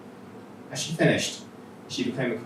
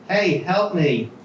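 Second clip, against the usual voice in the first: higher, louder, slow desperate call; calling for help; 7 semitones higher, 6 dB louder, much slower than usual. Acted. Nicole calls to the group, desperate shout. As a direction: call out to strangers for rescue.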